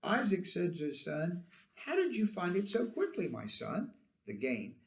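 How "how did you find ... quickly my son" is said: higher-pitched questioning, dropping on "my son"; puzzled, lightly acted query; doubting father. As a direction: question him with some doubt. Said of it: "Isaac is expressing some doubt still".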